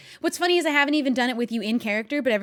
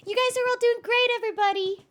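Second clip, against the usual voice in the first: much higher, bright sing-song encouragement; cheesy cheerleader; 12 semitones higher, 3 dB louder than usual. Pretend. [name] cheering the team in the voice Ollie hates, much higher. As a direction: chirp it like an overeager coach. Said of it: "the thing that you hate".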